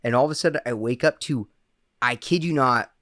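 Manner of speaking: usual speaking voice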